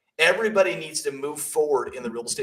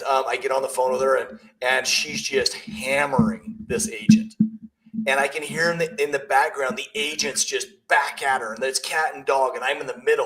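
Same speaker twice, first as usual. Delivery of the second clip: usual speaking voice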